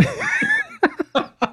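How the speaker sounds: shrieking high cackle; high laugh. Real, not acted. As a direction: cackle at your own joke. Laughing at own burn.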